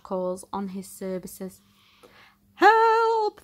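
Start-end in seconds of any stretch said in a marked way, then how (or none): 2.5-3.4 high, loud cry for help; shout for help. Acted. The Coast Guard crying out for help. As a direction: yell out to the hero. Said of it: "they shout".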